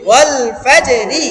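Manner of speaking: ওয়াল ফাজে রি again slow and even, each syllable separate. Shown as a demonstration. Teacher repeating the word slowly for students, syllable by syllable. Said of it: "একটা একটা করে বলেন".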